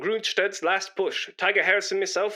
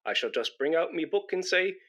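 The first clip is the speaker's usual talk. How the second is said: ordinary delivery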